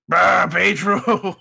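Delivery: loud, drawn-out comic wail; hammed-up mournful cry; put-on character voice. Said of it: "I forgot that was the voice".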